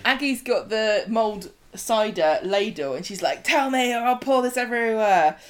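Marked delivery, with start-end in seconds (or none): 3.4-5.4 mock threat. Threatening Clint with a ladle.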